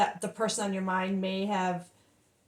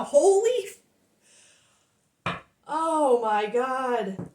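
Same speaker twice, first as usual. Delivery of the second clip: overwhelmed; 2 semitones higher, 3 dB louder, much slower than usual. Real, not acted. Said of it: "I'm emotional right now".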